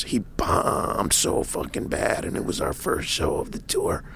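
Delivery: put-on slow, breathy near-whisper of an old road hand; imitating a weathered tour manager, voice dropped to a whisper; hushed confiding. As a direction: lean in and let the secret out softly.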